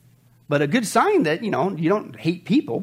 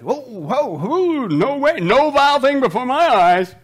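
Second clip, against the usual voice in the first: higher, slow shocked protest; mock recoil; 5 semitones higher, 5 dB louder, slower than usual. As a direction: act out recoiling from sin. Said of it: "not much of a battle going on".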